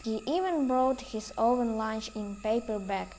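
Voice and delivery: usual speaking voice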